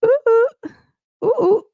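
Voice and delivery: high, loud sing-song oohs; giddy high-pitched oohs; high playful squeals. Real, not acted.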